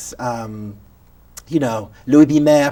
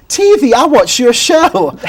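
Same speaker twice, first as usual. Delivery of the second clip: star recognizing him; 8 semitones higher, 8 dB louder, slower than usual. Retold or imitated. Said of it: "She said".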